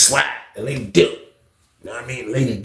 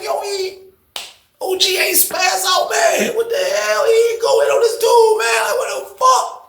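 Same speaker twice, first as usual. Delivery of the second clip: incredulous; 3 dB quieter, slower than usual. Retold or imitated.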